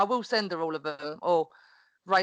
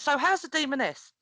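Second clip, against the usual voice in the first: higher, bright retold question from the teacher; teacher's cheery question; 7 semitones higher than usual. Teacher asking the mum about her wrestling persona, chirpy and higher. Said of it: "she turned around and went".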